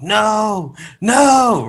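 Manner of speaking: high, loud wailed "no"; retold anguished scream. Fan retelling screaming through Philly, loud and anguished. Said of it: "just screaming at the top of my lungs".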